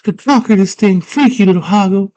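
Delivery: put-on high, defiant girl voice; headstrong girl voice. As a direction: sass him up high.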